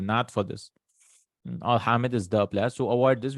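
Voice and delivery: normal talking voice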